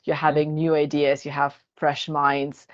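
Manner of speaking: normal talking voice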